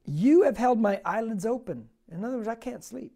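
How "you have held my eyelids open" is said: forceful scripture reading. Acted. Preacher reading Psalm seventy-seven aloud to the church, loud and declaiming. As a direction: declaim it to the congregation.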